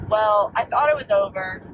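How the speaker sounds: normal talking voice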